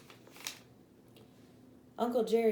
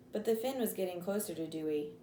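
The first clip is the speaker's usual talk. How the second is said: usual speaking voice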